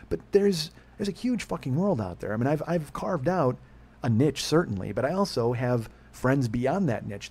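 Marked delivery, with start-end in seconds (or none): none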